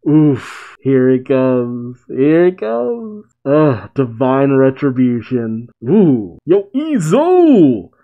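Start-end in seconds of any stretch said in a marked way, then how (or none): 0.8-2.0 slow, drawn-out anticipation; slow build-up. Real, not acted. Reacting to the manga reveal, slow and expectant. 2.1-3.3 higher, slow and thrilled; excited anticipation. Real, not acted. Reacting to the manga reveal, rising slow excitement. 6.4-7.9 excited shout-out. Real, not acted. Greeting a favourite character.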